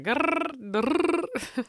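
high playful grr; growling noise. Pretend. Hustling servers.

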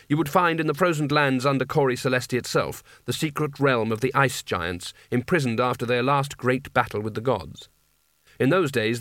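ordinary delivery

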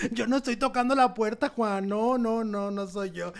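high pleading denial; a touch of high nervous pleading. Retold or imitated. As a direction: beg him to believe it wasn't you. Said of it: "Yo hasta le dije".

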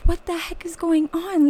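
high, bewildered cry of confusion; panicked confusion. Retold or imitated. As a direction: sound totally lost mid-interview. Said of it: "I was like".

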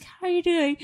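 high, gentle imitation of the boss asking; mimicking the boss. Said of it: "He's like".

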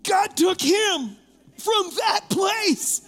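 can't stop laughing while telling it; squealing with laughter mid-sermon; laughing hard. Real, not acted. Preacher laughing through funny story.

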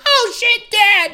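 high-pitched panicked kid impression; startled son caught out. Voicing a son caught by a parent, panicked high yelp. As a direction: yelp like a boy caught by Dad.

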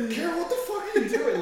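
high, fast startled yell; retold alarmed shout. Finding a friend naked in the bathtub, a high fast startled yell. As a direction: blurt it out in shock at the sight. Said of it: "And I was like".

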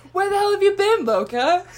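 Twilight quote. Retold or imitated. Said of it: "put that clip in the end".

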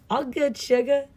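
high, lightly mocking "sugar"; tossing off "All good, sugar" up high; quoted sugar. Roommate to the guy in the elevator, high and breezy. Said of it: "for no reason".

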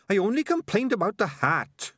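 high-pitched, impatient little rat; Sardines the rat. A narrator's reading. Rat placating a nagging companion, high and impatient. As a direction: cut off the argument in a high voice.

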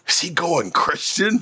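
mock surprise. Real, not acted.

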